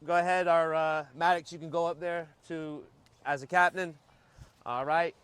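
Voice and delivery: usual speaking voice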